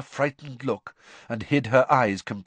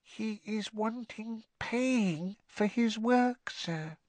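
hushed elf voice; 11 semitones higher, 5 dB quieter, slower than usual. Acted. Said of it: "lowered her voice by a half octave and whispered".

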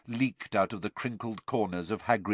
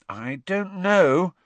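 high, drawn-out hesitation; slow, doubtful; 10 semitones higher, 5 dB louder, much slower than usual. A narrator's reading. Hermione unsure about going down to visit, slowly. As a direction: stretch each word out, louder and higher. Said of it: "Hermione said slowly".

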